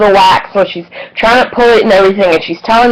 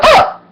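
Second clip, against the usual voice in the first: sudden high yelp of pain. Retold or imitated. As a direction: squeal it like the wax just ripped. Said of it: "and I went".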